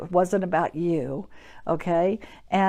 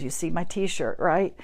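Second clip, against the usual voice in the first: ordinary delivery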